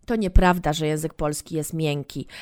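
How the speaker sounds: normal talking voice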